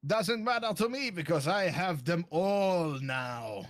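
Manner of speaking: slow, smug villain taunt. Acted. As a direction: drag out the taunt smugly. Villain taunts while fleeing.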